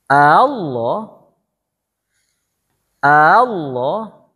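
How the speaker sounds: Allah with the second A softened and glided, ending in a rise; tashil reading. Shown as a demonstration. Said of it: "begitu bacanya".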